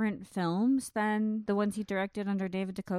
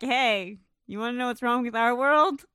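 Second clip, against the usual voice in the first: a touch of loud promo voice; mock sales pitch; 3 semitones higher, 6 dB louder than usual. Co-host jokingly selling Doctor Alien, mock promo pitch. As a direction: pitch it loudly, slowing grandly on the title.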